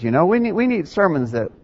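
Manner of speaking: ordinary delivery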